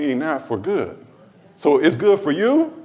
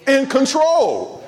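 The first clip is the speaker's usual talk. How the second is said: slow emphasis; 10 semitones higher, much slower than usual. Real, not acted. Preaching emphasis.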